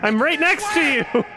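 high-pitched yell; exasperated shout. Real, not acted. Player to a yelling game character, exasperated shout. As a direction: shout back at someone calling you.

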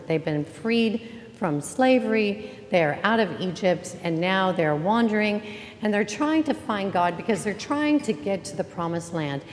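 ordinary delivery

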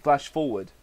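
plain reading voice

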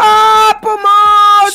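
loud, high cry for help; scream for help. Acted. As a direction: scream while being carried off. Kidnapped character screams. (křik o pomoc)

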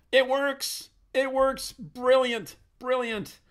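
high, loud delighted cheer; excited. Real, not acted. Tutor sees his PHP test page load, delighted high cheer. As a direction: burst out with joy as it finally works.

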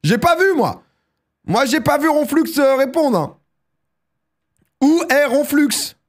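very high, loud cry of protest; insistent shouting. Real, not acted. Streamer hunting for the silent winner in chat, insistent shouting. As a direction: call out high, demanding the missing winner.

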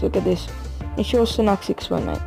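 ordinary delivery

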